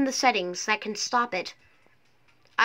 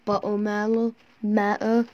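mock British accent. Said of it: "this time it's like buttermaller".

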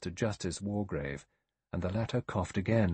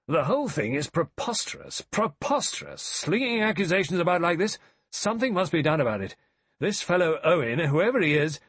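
high, loud, blustering outburst; indignant exclamation; 9 semitones higher, 6 dB louder than usual. A narrator's reading. General MacArthur protests the accusations, exclaiming indignantly. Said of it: "broke out suddenly. He exclaimed".

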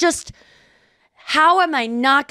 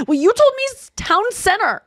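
much higher, bratty snap at the husband; mock-indignant, pitched way up; snippy attitude; 11 semitones higher than usual. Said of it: "had that, like, tude of".

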